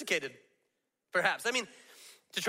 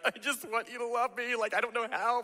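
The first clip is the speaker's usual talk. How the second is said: pleading parent; 5 semitones higher than usual. Retold or imitated. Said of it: "and you're like".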